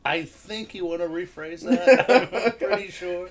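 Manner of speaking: high, loud, amused through laughter; real laughter breaking into speech; laughing. Real, not acted. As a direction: say it while cracking up. Laughing at innuendo.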